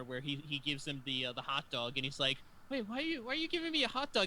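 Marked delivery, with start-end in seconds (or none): 2.7-4.3 high-pitched mock dismay; imitating horrified reaction. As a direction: act out horrified disbelief, pitched way up. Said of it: "he's just sitting there horrified".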